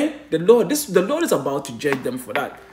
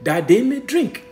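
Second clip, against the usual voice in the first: raised, louder pulpit voice; fiery preaching; 6 semitones higher, 4 dB louder than usual. Acted. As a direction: preach it hard to the congregation. Preaching at full force.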